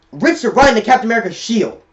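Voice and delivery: ordinary delivery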